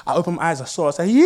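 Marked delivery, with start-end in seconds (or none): 1.1-1.2 loud, high burst of joy; excited yeah. Retold or imitated. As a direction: blurt it out thrilled. Excited shout on seeing wife.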